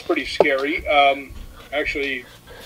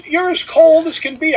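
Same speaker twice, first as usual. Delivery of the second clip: much higher mock girl voice; imitating second girl; 11 semitones higher than usual. Girl next to Rick reaching over, in a high imitated voice. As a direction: pitch way up as the other girl. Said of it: "and she goes".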